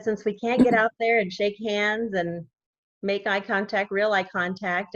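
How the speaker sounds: normal talking voice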